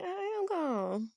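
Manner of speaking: high, slow, stretched-out protest; mock indignant calm. Customer answering the painter's "just calm down", mock indignant. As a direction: drag it out high in comic protest. Retells her reaction to painter.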